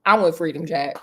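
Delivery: ordinary delivery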